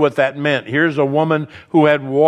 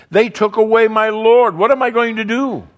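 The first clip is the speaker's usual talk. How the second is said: anguished cry; 7 semitones higher, 4 dB louder than usual. Acted. Mary's anguished cry voiced.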